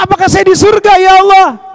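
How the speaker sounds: high, loud, bewildered question; amazed outcry. Acted. Revived sinner asks whether this is heaven, acted amazement. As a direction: call out in acted astonishment.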